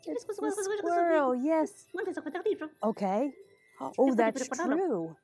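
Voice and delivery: plain reading voice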